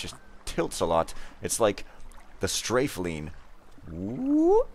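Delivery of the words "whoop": high, swooping whoop; cartoon whoop. Player mimics leaning out sideways, high cartoon whoop. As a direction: whoop like a cartoon sound effect.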